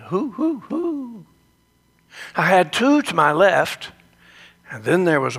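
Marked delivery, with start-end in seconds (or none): 0.1-1.3 high, softer owl hoots; owl hooting. Retold or imitated. Retelling the owls hooting in the yard, soft high hoots. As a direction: hoot gently up high like the owls. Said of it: "because they were hooting".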